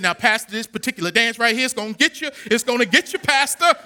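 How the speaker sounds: rising, loud excited promise; excited dad. Retold or imitated. Quan telling the pastor his daughter's dance will get him, excited. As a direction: build up to a shout on Pastor.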